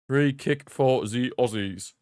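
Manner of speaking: slow, deliberate mock announcement; playfully drawn out. Commentator repeats the free kick call for fun, playfully drawn out. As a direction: space out every word playfully.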